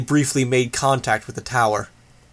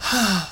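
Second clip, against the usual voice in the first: big theatrical sigh; hammed-up sigh; overdramatic sigh; 8 semitones higher than usual. A narrator's reading. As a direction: sigh like a bored teen. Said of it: "adding an overdramatic sigh".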